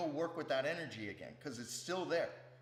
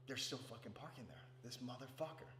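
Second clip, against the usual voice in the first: quiet grumbling; 10 dB quieter than usual. Acted. Annoyed resident grumbling about the parking neighbor, hushed grumble. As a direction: curse the offender under your breath.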